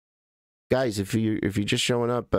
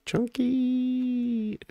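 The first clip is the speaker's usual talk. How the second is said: name held long on one high note; high drawn-out greeting. Real, not acted. Streamer greeting a regular viewer, high drawn-out call. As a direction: sing out the name, very high and stretched.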